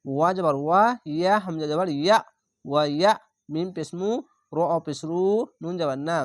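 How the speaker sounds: normal talking voice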